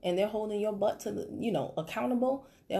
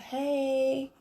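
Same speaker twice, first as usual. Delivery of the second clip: drawn-out, higher "what's supper"; mock lazy message; 5 semitones higher, much slower than usual. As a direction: parody a pointless opener. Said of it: "I would never message somebody and be like".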